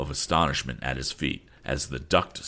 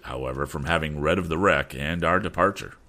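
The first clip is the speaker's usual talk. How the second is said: usual speaking voice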